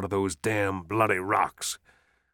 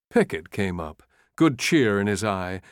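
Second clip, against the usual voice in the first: ordinary delivery, nothing marked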